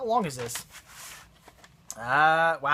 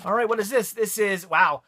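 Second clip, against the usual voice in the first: ordinary delivery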